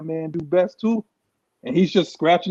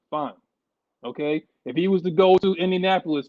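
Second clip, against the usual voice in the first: ordinary talk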